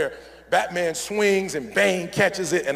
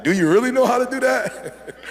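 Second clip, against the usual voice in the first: higher, louder, laughing through the question; laughing mock challenge; 6 semitones higher, 5 dB louder than usual. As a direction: tease them with a laugh, pitched up. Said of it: "I'm like".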